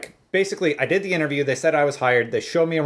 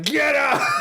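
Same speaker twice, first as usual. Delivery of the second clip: much higher, shouted, cracking into laughter; furious get out; 13 semitones higher than usual. Retold or imitated. Boss ordering the teen out of his store, furious. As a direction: throw him out with a yell. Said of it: "He was, like, pissed".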